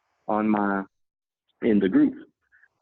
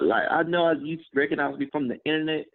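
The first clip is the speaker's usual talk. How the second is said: ordinary talk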